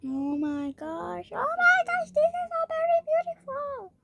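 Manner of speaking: over-the-top gushing; awestruck delight. Pretend. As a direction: build to a very high, loud squeal. Gushing over a beautiful item.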